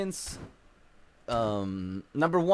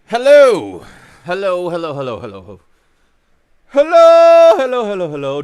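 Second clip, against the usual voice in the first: mic check; 13 dB louder than usual. Real, not acted. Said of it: "I'm ready to go".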